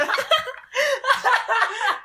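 long high-pitched laugh. Real, not acted. Big laugh after joke.

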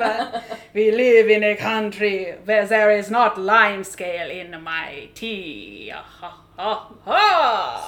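put-on slow, loud Hungarian vampire accent; hammy Hungarian accent with a villain's laugh. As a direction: draw it out in a thick Hungarian accent. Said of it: "like my grandfather from Hungary".

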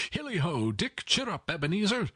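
high, merry hollering; rally the lads in a jolly high voice; Fezziwig. A narrator's reading. Master cheering on two apprentices, high and jovial. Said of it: "cried old Fezziwig".

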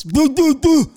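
high, chattering imitation of a squirrel stuffing its face; mock squirrel chatter. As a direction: squeak it out like a squirrel with cheeks full of nuts. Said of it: "He sounds like a squirrel getting ready for winter".

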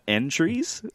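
"entries" said as EN-trees, slowly, questioning; mispronounced entrées. Retold or imitated. Friend ordering from the menu, mispronouncing "entries" as EN-treez. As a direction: read "entries" just as spelt, EN-treez. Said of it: "And we're like, "Entries?".

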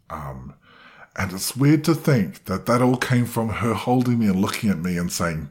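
normal talking voice

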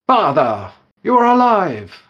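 high, loud joyful shout; overjoyed cry. Acted. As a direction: burst out with relief and joy. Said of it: "R four first goes".

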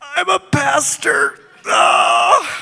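sudden high shouted no, half-joking; inner protest. Retold or imitated. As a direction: blurt it out loud and high like a mock panic. Inner protest, confessing he's pastor.

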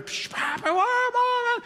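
high, put-on wailing child; mock a kid bawling for Papa; whiny kid. Parent mimics kids fighting.

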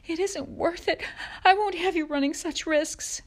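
high-pitched, tearful outburst; sobbing. A narrator's reading. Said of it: "Ma sobbed".

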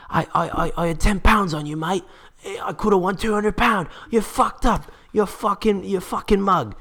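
very high mock Cockney accent; squeaky, put-on Cockney fan abuse.

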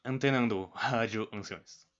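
slow announcer intro; radio announcer. Pretend. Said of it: "intro nova aí".

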